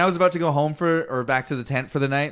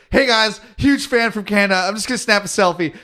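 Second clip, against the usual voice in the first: higher, louder excited fan act; retold eager greeting; 7 semitones higher, 5 dB louder than usual. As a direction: burst in like a keen fan. Retold barging in on the band.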